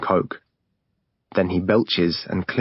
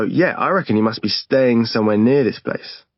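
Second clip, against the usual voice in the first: usual speaking voice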